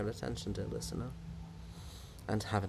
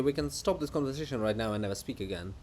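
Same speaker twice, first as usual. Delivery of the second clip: ordinary talk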